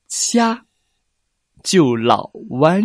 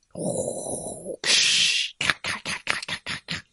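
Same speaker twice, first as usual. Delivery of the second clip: whispered clacking sound; 9 dB quieter than usual. Acted. Giant lobster emerges.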